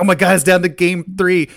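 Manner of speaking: loud, high excited re-enactment; freaking out. Retold or imitated. Friends following the final game, freaking out. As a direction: blurt it out like a fan on edge. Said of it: "just, like, freaking out".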